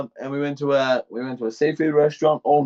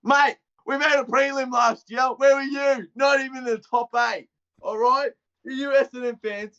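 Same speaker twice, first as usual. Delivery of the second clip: raised pitch, worked-up fan rant; heated rant; 5 semitones higher than usual. Real, not acted. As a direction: let rip at the rival fans, voice up high. Ranting at Essendon fans.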